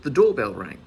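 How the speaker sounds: ordinary delivery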